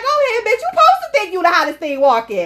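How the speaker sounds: high, loud hype-woman cheer; hyped encouragement. Retold or imitated. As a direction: cheer her on loudly. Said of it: "I be like".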